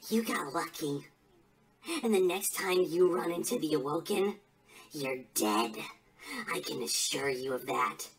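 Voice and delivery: quiet, low-pitched threat; cold villain's warning, very low; low menace. Acted. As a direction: drop down into a soft, deadly promise. Villain threatens the rival team.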